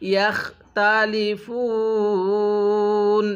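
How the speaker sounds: slow, drawn-out Arabic recitation; ধীরে তিলাওয়াত; slow Quran recitation. Shown as a demonstration. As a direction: recite each syllable slowly for the student. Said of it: "কিভাবে পড়ব?".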